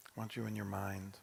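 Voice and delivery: ordinary delivery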